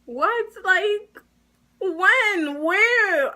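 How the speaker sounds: high-pitched, baffled questions; incredulous. Retold or imitated. Stunned teen retelling their reply to being accused of bullying, incredulous. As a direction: fire off disbelieving questions, voice shooting up.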